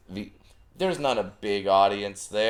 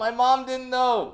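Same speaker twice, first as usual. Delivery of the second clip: excited. Real, not acted. Retelling with excitement.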